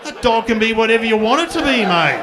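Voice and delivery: loud hammed-up dad shutting it down; exasperated dad. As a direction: bark it out with mock logic. Dad dismissing.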